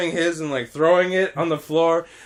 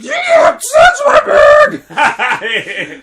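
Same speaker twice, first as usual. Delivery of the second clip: long, very high, loud shriek; crying scream; 10 dB louder, much slower than usual. Retold or imitated. Child who thinks teacher is stealing, drawn-out screaming. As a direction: shriek it slowly like a bawling child. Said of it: "crying and just like".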